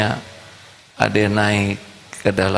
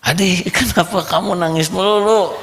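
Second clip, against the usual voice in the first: put-on high giggly kid voice; laughing child; 11 semitones higher than usual. Boy laughingly asks friend why he keeps crying, high giggle. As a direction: laugh while asking.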